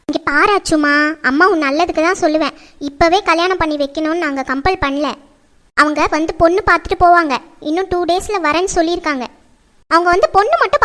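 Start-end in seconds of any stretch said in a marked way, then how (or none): none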